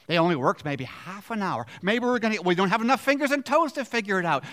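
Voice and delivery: eager, loud workers counting up their pay; excited scheming. Acted. Voicing workers' imagined thoughts.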